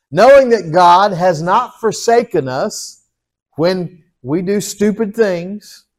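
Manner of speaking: ordinary delivery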